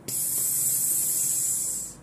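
a soft, long whispered hiss of escaping air; air leaking hiss. Retold or imitated. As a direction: mimic the leaking rubber horse, breathy and faint. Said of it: "usłyszeliśmy".

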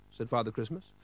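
plain reading voice

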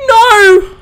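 high, loud frightened cry. Real, not acted. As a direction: plead in sudden fear. Monster approaching in game.

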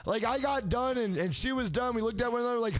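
ordinary talk, nothing marked

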